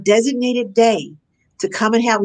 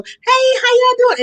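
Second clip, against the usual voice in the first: mock-cheery hello, pitched way up and louder; breezy parent greeting; 4 dB louder than usual. Parent dropping into the kids' lunch, chirpy high greeting. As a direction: play the parent popping in all sing-song.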